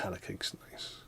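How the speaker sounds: breathy whisper, barely audible; whispered aside. Real, not acted. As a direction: murmur it under your breath.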